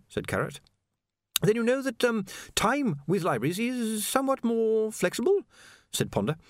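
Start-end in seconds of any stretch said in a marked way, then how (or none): 1.4-5.4 high, stammering anxious scholar; a jittery young wizard stammering high; nervous wizard. A narrator's reading. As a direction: stumble over every word, flustered. Ponder explains.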